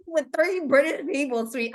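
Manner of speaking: high, mock-incredulous quote; imitating teasing listeners. Listeners calling out Sweet over three British people, mock outcry. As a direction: pitch it up as the protesting crowd. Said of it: "y'all be like".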